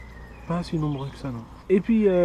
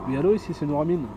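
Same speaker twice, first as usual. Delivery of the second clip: usual speaking voice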